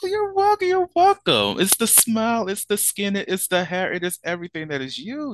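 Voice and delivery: high, bright gushing praise. Real, not acted. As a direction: pile on the compliments eagerly. Gushing over guest's compliment.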